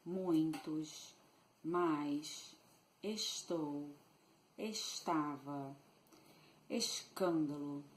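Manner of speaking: "Muitos, mais, estou, estava, escândalo" with S said sh. Shown as a demonstration. Teacher demonstrating Rio's S, "Muitos, mais, estou" with sh. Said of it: "it's also correct to make a sh sound".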